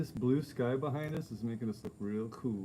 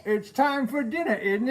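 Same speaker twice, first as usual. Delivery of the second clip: high, louder hammed-up British voice; mock British accent; 9 semitones higher, 5 dB louder than usual. Husband finishes British accent bit, laughing. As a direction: end on a posh little rise. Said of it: "you do the British one".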